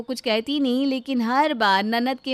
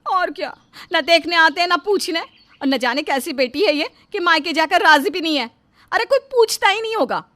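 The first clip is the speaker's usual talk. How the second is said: loud, scolding matriarch; acted indignant tirade, voice raised; angry mother-in-law; 7 semitones higher, 10 dB louder than usual. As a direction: berate the daughter-in-law with contempt. Narrator acts mother-in-law berating bride.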